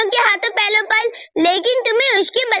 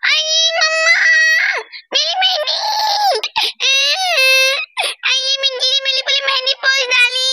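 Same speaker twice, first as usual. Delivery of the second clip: put-on childish wailing; 3 semitones higher than usual. Girl cries to mamma over ruined henna, wailing. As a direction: whine and wail in a raised pitch.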